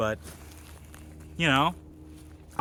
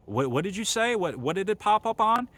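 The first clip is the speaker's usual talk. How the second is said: higher-pitched mock confusion; stumbling, puzzled question pitched up; puzzled interviewer; 7 semitones higher than usual. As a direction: imitate someone baffled, asking for a repeat. Puzzled interviewer in a retold exchange.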